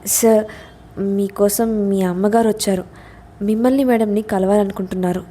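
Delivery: plain reading voice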